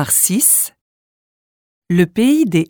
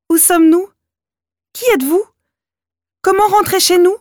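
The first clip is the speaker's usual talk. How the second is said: put-on child voice; 11 semitones higher, faster than usual. A narrator's reading. Oscar asks anxiously.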